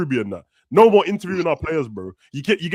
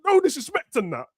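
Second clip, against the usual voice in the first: much higher, indignant half-joke; joking rant; 9 semitones higher than usual. Real, not acted. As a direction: protest high-pitched in mock outrage.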